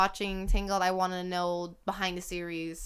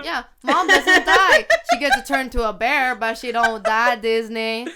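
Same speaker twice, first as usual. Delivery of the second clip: excited emphasis; 3 semitones higher, 12 dB louder than usual. Acted. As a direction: hammer it home playfully. Playful rant about the mom.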